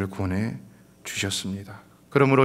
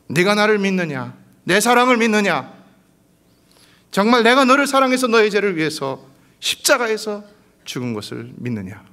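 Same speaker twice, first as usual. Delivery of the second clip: high, loud pleading question of God; voice of God asking; 10 semitones higher, 7 dB louder than usual. Acted. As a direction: call out as God asking his child. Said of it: "이 한 가지만 물어보십니다". (하나님이 묻는 높고 큰 목소리)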